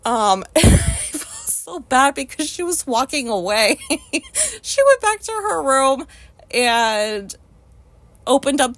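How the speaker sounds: laughing through the words; giggling while telling it. Real, not acted. Laughs retelling the story.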